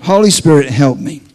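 loud, emphatic call for help; loud plea. Acted. Preacher stalling before interpreting the dream, loud plea. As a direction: call it out strongly to heaven.

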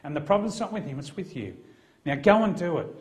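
forceful, no-nonsense command; assertive. Retold or imitated. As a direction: lay down the law to a squabbling couple. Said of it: "Now that sounds pretty assertive".

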